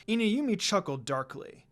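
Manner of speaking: ordinary delivery, nothing marked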